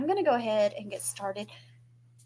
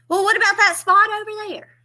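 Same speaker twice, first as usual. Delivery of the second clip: high, loud fussy mother pointing; mama again; 10 semitones higher, 10 dB louder than usual. Retold or imitated. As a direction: fuss over another spot like mama. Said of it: "She points at another one".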